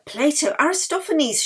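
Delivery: ordinary delivery, nothing marked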